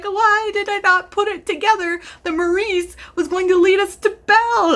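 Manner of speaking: high-pitched exasperated squeal; excited disbelief. Retold or imitated. Reader scolding herself for missing it, high squeal. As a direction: cry it out, amazed at yourself. Retold realization of twist.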